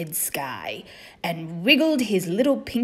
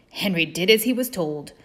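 ordinary delivery, nothing marked

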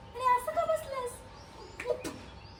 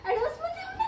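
high incredulous repeat; can't believe what she heard; shocked echo; 12 semitones higher, faster than usual. Acted. (आश्चर्याने उंच आवाजात प्रश्न)

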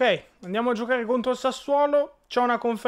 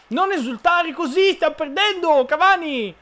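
yelling; 8 semitones higher, 5 dB louder than usual. Real, not acted. Yelling at celebrating opponent.

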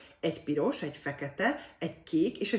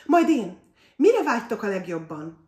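high, loud cut-in; eager interruption; 4 semitones higher, 9 dB louder than usual. A narrator's reading. Lili interrupts the fairy boy, eager cut-in. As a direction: jump in before the others. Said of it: "vágott közbe Tündér Lili".

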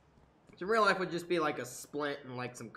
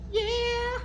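excited cheer; 6 dB louder than usual. Real, not acted. Cheering success.

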